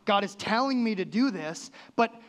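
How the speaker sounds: a hammed-up skeptic's objection; mock indignant protest. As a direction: scoff at the verse as impossible. Mock indignant reader's reaction.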